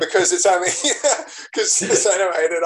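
talking through laughter; laughing. Real, not acted. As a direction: explain the joke while chuckling. Laughing at the Bambi joke.

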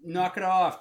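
loud sharp yell. Retold or imitated. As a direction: shout to stop a dog fight. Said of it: "I'm like".

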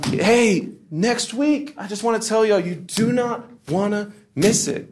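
high, sing-song mock hype; church emcee. Emcee plugging next week yet again, exaggerated high hype. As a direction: parody an over-animated announcer. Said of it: "it's the same thing".

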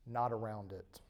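normal talking voice

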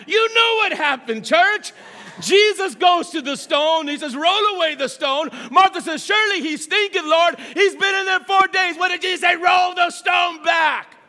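rising, slow, forceful sermon voice; fiery preaching. Acted. Preacher stirring up the church, full force. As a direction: build the fire, let it swell.

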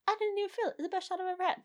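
high, airy mock voice of people who lied; sing-song high parody of everyone's claims; mocking liars. Mocking people who said the shot didn't hurt, high and airy. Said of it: "everybody lied and they were like".

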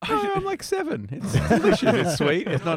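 put-on childlike voice. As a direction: answer like an excited child. Playing a child to retort.

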